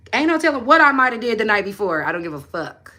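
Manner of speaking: ordinary delivery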